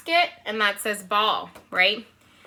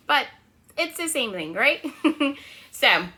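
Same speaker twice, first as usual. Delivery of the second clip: ordinary delivery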